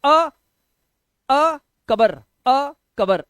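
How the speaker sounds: अकबर broken as a lone अ, gap, then कबर; wrong split reading. Shown as a demonstration. Teacher showing another way nobody says it, wrong split reading. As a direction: call out अ loudly by itself, then कबर. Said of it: "ऐसे नहीं बोलते".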